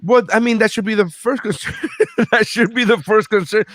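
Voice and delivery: high-pitched, laughing retort; real amused protest breaking into laughs. Real, not acted. Laughing at co-host's complaint.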